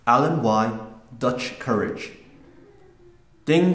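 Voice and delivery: ordinary delivery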